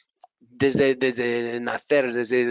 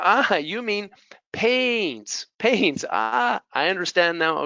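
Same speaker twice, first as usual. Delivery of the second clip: pitched-up dawning understanding; higher, drawn-out "paints" as it clicks; acted realization; 6 semitones higher, slower than usual. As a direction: act out finally getting it. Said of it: "me doy cuenta".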